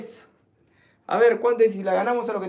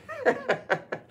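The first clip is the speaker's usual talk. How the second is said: high, light giggle; laughing; 8 semitones higher, 5 dB quieter than usual. Real, not acted. Host amused by Casa del Triple's message, laughing.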